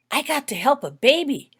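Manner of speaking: high, loud, bubbling with excitement; a little girl bursting to tell; eager child's voice; excited child. A narrator's reading. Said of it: "Holly excitedly said".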